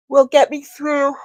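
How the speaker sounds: talking through a yawn. Real, not acted. Livestreamer caught by a yawn mid-sentence, yawning. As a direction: let a yawn rise into the words. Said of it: "I'm yawning in your face".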